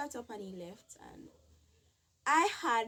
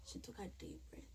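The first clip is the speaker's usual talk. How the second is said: whisper; 12 dB quieter, faster than usual. Real, not acted.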